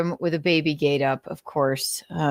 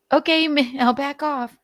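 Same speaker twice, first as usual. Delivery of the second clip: high, meek backing down; mock timid reply; 8 semitones higher than usual. Meek friend backing off from the drug talk, mock timid. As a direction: play the scolder giving up, pitched way up. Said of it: "And they were like".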